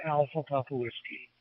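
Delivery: ordinary delivery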